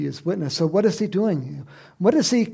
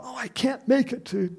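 higher-pitched mock excuse; mock excuse-making; 8 semitones higher than usual. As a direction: imitate someone begging off. Mimics people making excuses.

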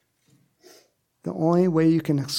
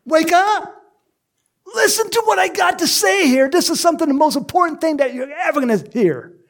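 much higher, loud commanding call; Jesus shouting to the dying thief; urgent shout; 13 semitones higher, 5 dB louder, faster than usual. Acted. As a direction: shout to wake him up. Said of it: "he's saying".

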